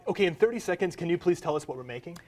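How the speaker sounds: usual speaking voice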